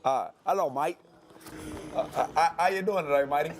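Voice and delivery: put-on jokey Australian accent trying out a hello; mock Australian accent. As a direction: ham up an Aussie accent to greet the host. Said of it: "I wanna get my accent a little bit ready".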